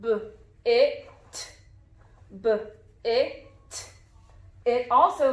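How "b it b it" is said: "B, it" as buh, short i with crisp t; sounding out. Shown as a demonstration. Teacher checks the I sound, "B, it" sound by sound. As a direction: separate the sounds in "B, it" with pauses. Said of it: "What about the I?".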